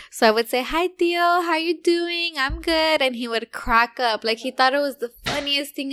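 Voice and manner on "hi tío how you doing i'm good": higher, lightly voiced baby daughter; speaking as baby. Mother answering the uncle as baby Olivia, baby voice. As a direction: talk for the baby in a raised little voice. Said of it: "but as Olivia".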